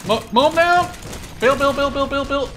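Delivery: high, loud battle yell; frantic shout. Real, not acted. Player yelling at teammates to build, loud frantic shout. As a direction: bark the orders at your squad.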